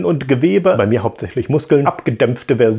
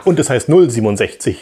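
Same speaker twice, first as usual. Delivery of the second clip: ordinary talk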